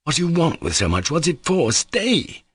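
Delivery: alarmed cry, climbing in pitch. A narrator's reading. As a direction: call out to stop him. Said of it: "cried Pyotr Ilyitch".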